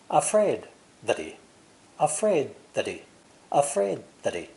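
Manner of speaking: "Afraid that he" linked, "he" said as "ee"; he without H. Shown as a demonstration. Teacher linking the three words without the H. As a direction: run "afraid that he" together, drop the H. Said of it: "pronounced without the H sound".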